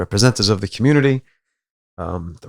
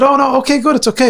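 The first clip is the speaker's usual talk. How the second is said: very high eager reassurance; relieved elders; 4 dB louder than usual. Retold or imitated. Elders reassuring third boy, very high relief. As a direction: soothe him, pitch way up. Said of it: "And they said".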